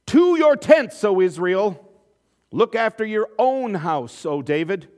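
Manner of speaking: high, loud call to rebellion; rally the tribes to walk away; defiant rallying cry. Acted. Israelites declaring independence to the king, shouting defiantly.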